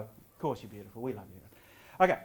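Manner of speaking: normal talking voice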